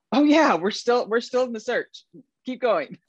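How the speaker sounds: high, bright-toned quick cover-up; hammed-up reassurance to the handler; mock cheerful cover. Covering brain burp cheerfully.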